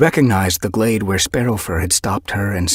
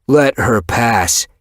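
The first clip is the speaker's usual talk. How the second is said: slow warning; 4 semitones higher, 5 dB louder, much slower than usual. Acted. Dust Muzzle confronts Shattered Ice.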